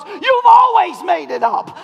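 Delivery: wife realising it was always made up, slow and loud; loud slow accusation. Retold or imitated. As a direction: drag out the accusation loudly. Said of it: "she goes".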